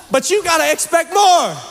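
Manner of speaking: high, mock-emphatic exhortation; playful shout. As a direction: call it out high to your neighbor in fun. Playful call-out to a neighbor.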